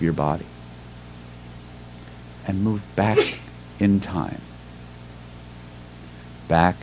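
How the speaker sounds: hypnotic visualization voice, measured phrases with long pauses; guided meditation. Real, not acted.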